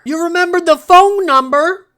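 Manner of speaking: high, loud mock disbelief; incredulous outburst. Finn challenging the forgetful ghost, loud high incredulous outburst. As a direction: squawk it in hammed-up incredulity.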